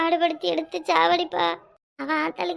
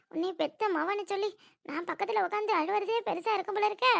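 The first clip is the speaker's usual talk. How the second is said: quiet muttering; 10 dB quieter than usual. Acted. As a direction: mutter it under your breath to mother. Alone beside mother, muttering taunts. (தாழ்ந்த குரலில் முணுமுணுப்பு)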